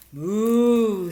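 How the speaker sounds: loud mooing cow; loud cow's moo. A narrator's reading. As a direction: bellow it like a cow. Cow moos.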